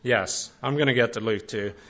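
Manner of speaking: usual speaking voice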